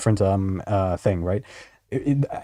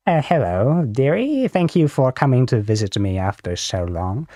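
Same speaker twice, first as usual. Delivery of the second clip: grandmother voice. Retold or imitated. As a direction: coo like a doting grandma. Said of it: "I sound like your grandmother".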